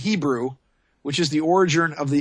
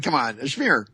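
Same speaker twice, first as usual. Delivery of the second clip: ordinary delivery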